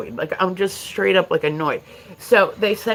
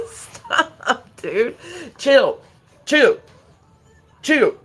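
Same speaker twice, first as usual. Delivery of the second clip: stern raised voice at a pet; firm scolding; 6 semitones higher, much slower than usual. Real, not acted. Scolding the dog on stream.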